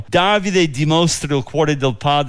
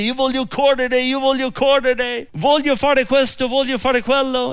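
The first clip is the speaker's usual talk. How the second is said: high-pitched, insistent eager-runner voice; mock overzealous pleading; 8 semitones higher than usual. Ahimaaz begs Joab to let him run, mock overzealous pleading. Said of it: "con tutto questo zelo, zelante".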